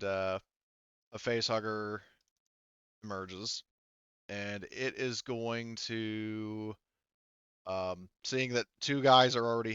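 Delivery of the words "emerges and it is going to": ordinary talk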